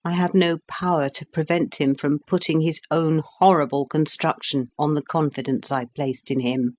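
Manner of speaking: usual speaking voice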